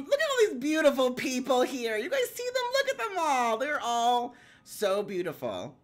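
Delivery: very high, loud gushing; ear-splitting excitement. Real, not acted. Streamer showing off the beautiful guests, ear-splitting excitement. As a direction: squeal it out at full volume. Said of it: "I just made Will's ears hurt".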